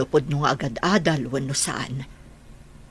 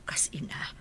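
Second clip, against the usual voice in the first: an unvoiced whisper, much softer; 12 dB quieter than usual. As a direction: breathe the words out as a mother's confession. Mother confiding her feelings.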